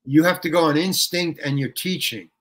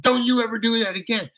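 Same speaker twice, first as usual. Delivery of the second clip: higher angry backstage tirade; retold angry shouting; 6 semitones higher than usual. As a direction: chew out the actor who wrecked the show. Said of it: "were really shouting at me".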